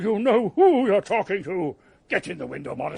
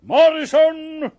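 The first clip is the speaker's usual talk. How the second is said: calling out; 7 semitones higher, 7 dB louder, slower than usual. Acted. Boss calling again.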